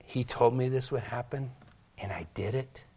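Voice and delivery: whispered inner confession; remorseful whisper. Acted. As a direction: whisper it to yourself in shame. Peter's remorseful inner thought.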